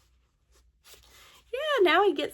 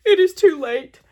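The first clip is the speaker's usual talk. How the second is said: louder, mock-tragic goodbye; melodramatic farewell; 5 dB louder than usual. Gerald tells Piggy not to speak, loud mock-tragic farewell.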